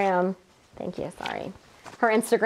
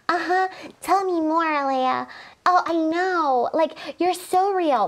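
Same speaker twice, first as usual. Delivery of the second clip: put-on high, slow valley-girl gushing; drawn-out fake sweetness, lightly done; mocking her gushing voice; 6 semitones higher, slower than usual. Co-host mimicking a contestant's gushing manner, mock-sweet. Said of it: "I couldn't stop talking like her either".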